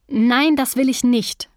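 angry shouted refusal; shouting. A narrator's reading. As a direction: shout it right at him, every word weighted. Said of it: "schrie ich ihn an".

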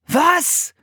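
high, startled yell; shouted disbelief. A narrator's reading. Philipp shocked at his sister staying, yelling. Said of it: "schrie Philipp".

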